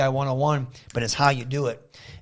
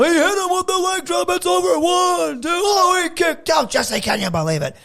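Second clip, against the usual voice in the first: much higher, fast shouted play-by-play of the pin; shouting announcer. Retold or imitated. Boy shouting the count for his teddy-bear match, high and fast. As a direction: call the count like a frantic TV announcer. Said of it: "I would do all the promos and stuff".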